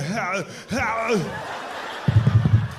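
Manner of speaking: long mock groan of straining; imitated action-hero grunt. Imitates the noise in public bathroom.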